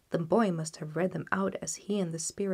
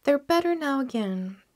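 mother reassuring; 5 semitones higher, 5 dB louder than usual. A narrator's reading. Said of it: "said Cratchit's wife".

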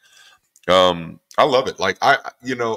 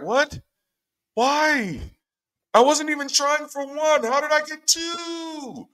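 high-pitched wailing protest; hammed-up, high incredulous squeal; shocked disbelief; 3 dB louder, slower than usual. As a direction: cry out in comic dismay, stretching the last word. Acts mother's shock at twins.

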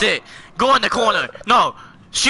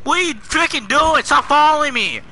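higher-pitched angry complaint; exasperated yelling; 4 semitones higher than usual. Real, not acted. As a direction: tell off a pest following you. Player yells at following child.

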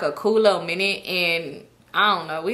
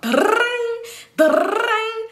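much higher mock ringtone; imitated phone ring; 12 semitones higher than usual. Phone ringing at ten.

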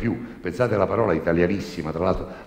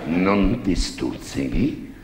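very slow, prying confessor; whisper-slow nosy priest; 3 dB louder, much slower than usual. Acted. Priest questions the girl.

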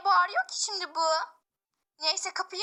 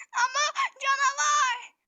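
insistent fright; 6 semitones higher, 3 dB louder than usual. Acted. Child insists on monster.

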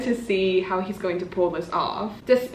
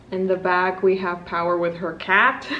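ordinary talk, nothing marked

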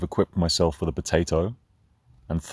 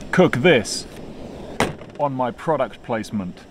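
hyped YouTube cooking host; 4 semitones higher, slower than usual. Pretend. Vlogger parodying a popular YouTube cooking show, hyped host voice. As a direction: bark each step like a frantic online chef. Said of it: "in the style of a popular YouTube show".

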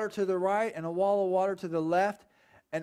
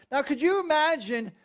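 normal talking voice

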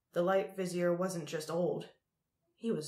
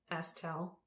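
ordinary delivery, nothing marked

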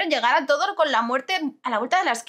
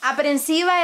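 ordinary delivery

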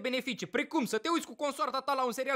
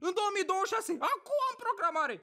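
high, mock-exasperated retort; irritated sarcasm; 7 semitones higher than usual. Patient answering receptionist about appointment, mock exasperated. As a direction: fire back impatiently in a raised pitch.

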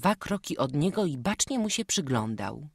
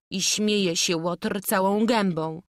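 ordinary delivery, nothing marked

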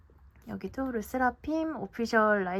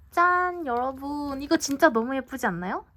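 loud, higher-pitched ta-da to the viewers; excited reveal; 4 semitones higher, 5 dB louder than usual. Real, not acted. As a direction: show it off with delight. Showing off pretty new merch. (신나서 자랑하는 목소리)

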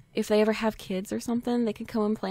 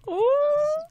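very high squealed ooh; thrilled high-pitched ooh; really excited; 4 dB louder than usual. Real, not acted. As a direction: squeal with delight at the romance. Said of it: "like, really excited about this".

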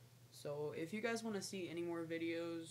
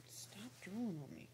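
whispering; 4 semitones higher than usual. Real, not acted. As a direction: whisper it fondly. Snake drooling on her.

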